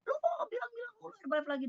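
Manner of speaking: very high, fast mock rant; menirukan omelan marah. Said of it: "Dia marah-marah sama saya". (menirukan omelan marah, cepat dan melengking)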